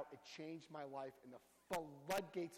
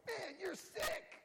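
very high, drawn-out mock disgust; mock appalled reaction; 3 dB louder, much slower than usual. Sponsor blurting at someone's confession, mock appalled. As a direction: blurt it in exaggerated, squealing shock. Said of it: "try to stay straight-faced".

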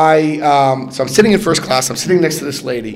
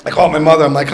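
normal talking voice

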